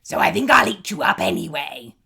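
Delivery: troll deciding to eat the goat, loud. A narrator's reading. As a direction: bellow it like a greedy troll.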